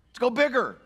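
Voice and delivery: high, loud eager push; greedy excitement. Acted. Pastor voicing the rich man, high and eager. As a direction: get carried away with greed. Rich fool voiced in parable.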